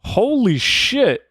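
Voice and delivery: real astonishment at the drink; shocked. Real, not acted. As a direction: stretch it out in disbelief.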